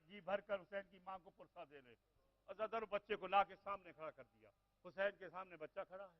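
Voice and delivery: ordinary delivery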